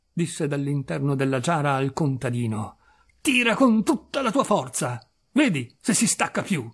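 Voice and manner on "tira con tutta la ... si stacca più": higher-pitched, emphatic challenge; animated, goading order; insistent command. Acted. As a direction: urge him to pull with all his might. Challenging the farmhand to pull.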